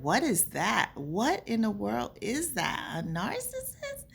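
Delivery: baffled questions climbing to a high squeak; incredulous retold questioning; retold bafflement. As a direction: act out disbelief, ending very high. Retelling her own baffled questions.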